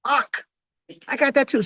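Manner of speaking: high alarmed self-diagnosis; mock dismay. Passive aggressive fits him too: a high, alarmed exclamation. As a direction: jolt up in pitch at each new match. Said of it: "I'm like".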